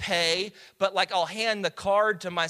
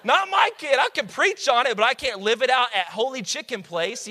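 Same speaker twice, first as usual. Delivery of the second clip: higher, louder, amazed at his own son; amazed disbelief; 5 semitones higher, 4 dB louder than usual. Retold or imitated. Pastor marvelling at his son's kindness, high and loud. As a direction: marvel aloud at whose kid this is. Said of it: "I'm like".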